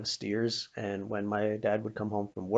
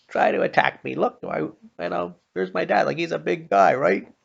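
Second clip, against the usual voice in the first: higher, louder cocky jeer at monsters; taunting bravado; 8 semitones higher, 8 dB louder than usual. Retold or imitated. Boy taunts barn monsters with his dad beside him, cocky and loud. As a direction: gloat like a kid safe behind his big dad.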